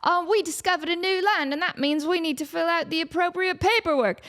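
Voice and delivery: formal clipped bureaucrat; nerdy intern. Retold or imitated. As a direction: intern addressing the drunk explorers. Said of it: "he's like".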